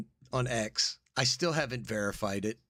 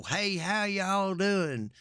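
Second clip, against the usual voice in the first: put-on Southern accent; 8 semitones higher than usual. Mocking Southern dialect for a study, high and hammed up. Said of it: "the Southern dialect in the United States".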